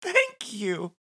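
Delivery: high, gushing wife voice; Nadine voice. Acted. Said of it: "she says".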